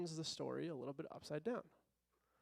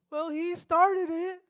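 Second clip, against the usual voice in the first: put-on much higher, louder, slow whiny child voice; whiny kid; 11 semitones higher, 15 dB louder, slower than usual. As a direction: drag it out like a kid blaming another. Kid's excuse in retold scene.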